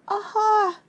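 high, strained little Aha!; a frightened squeak of an Aha; weak aha. Acted. Scared Piglet trying to intimidate Kanga. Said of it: "wasn't a very good aha".

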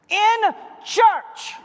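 "In church" called out loud and high; emphatic shout; preacher shout. Acted. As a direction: proclaim it to the room. Preacher answering his question.

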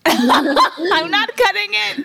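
laughing high and slow. Real, not acted. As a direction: laugh through the refusal. Laughing refusal to cut clip.

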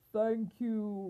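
grateful storybook lion; roaring lion. A narrator's reading. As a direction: roar out thanks like a lion. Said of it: "roared the lion".

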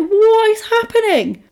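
very high, baffled cry; hammed-up retold disbelief; mock bewilderment. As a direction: imitate utter confusion, pitched right up. Voicing boy held by neck.

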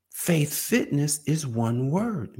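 each word of "Faith fitness is one word" drawn out very slowly; slow, deliberate repeat of "Faith fitness is one word"; slow emphasis. Real, not acted. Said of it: "I realized that faith fitness is one word".

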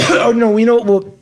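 strained after a coughing fit; choking on a drink. Real, not acted. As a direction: voice tight from choking. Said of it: "I'm only choking on my own fucking breath".

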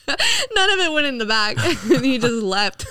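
words breaking up into laughter, high and drawn out; laughing while telling. Real, not acted. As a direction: tell it through helpless real laughter. Laughing through her own mishap.

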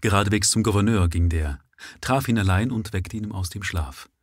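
ordinary delivery, nothing marked

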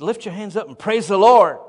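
rallying church call; urgent exhortation. Retold or imitated. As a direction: urge her to praise, swelling on the last word. Preacher urging a woman to praise.

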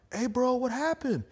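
high-pitched friendly call. Retold or imitated. To his friend leaving the club, a high friendly call. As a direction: pitch jumps way up on a casual greeting. Retelling drunk chat with friend.

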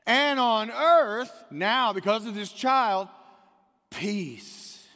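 loud, high, slow pulpit proclamation; preaching at full force. Acted. Preacher proclaiming the angels' song to the church, loud and high. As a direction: build it up and proclaim it. Sermon climax on Christmas peace.

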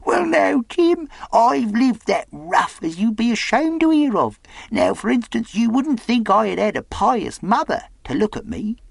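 high-pitched eccentric sailor; confiding castaway. Acted. Castaway confides his rough life to Jim, eccentric and high. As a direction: chatter in a high, rough-living seadog character voice.

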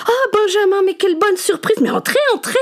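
high, gushing landlady voice; haughty aristocratic french. A narrator's reading. Martha greets visitor.

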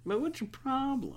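mock exasperation. Ranting at slow drivers.

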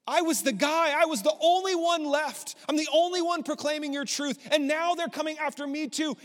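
high, loud, exasperated complaint; anguished outburst. Acted. Elijah venting to God that he is alone, high and anguished.

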